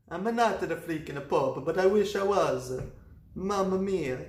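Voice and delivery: mild mock Italian; light Italian priest. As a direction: sigh it like a wistful cartoon priest.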